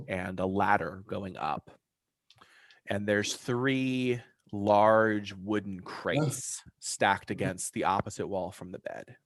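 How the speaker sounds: ordinary talk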